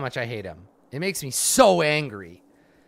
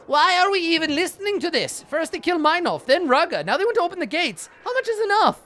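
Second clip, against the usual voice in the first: much higher, louder rabble-rousing voice; loud appeal; 13 semitones higher than usual. Acted. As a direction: whip up the crowd from up high. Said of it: "speaking loudly".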